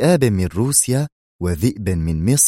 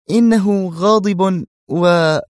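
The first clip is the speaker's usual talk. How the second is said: high, slow, louder woman's voice; wary surprise; 10 semitones higher, 5 dB louder, slower than usual. Acted. Zosha answering warily before Yuri interrupts, high and slow. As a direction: cut off mid-thought. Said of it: "حدقت فيه بدهشة حذرة".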